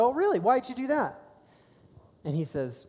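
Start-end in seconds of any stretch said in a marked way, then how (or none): 0.0-1.2 mock surprise. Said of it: "I said".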